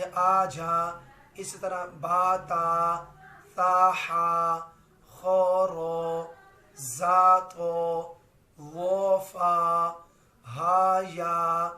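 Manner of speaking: slow loud tajweed recitation of letters; drawn-out letter recitation. Shown as a demonstration. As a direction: chant the letters slowly, holding every vowel. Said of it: "उनकी मैंने एक मिसाल भी दी थी".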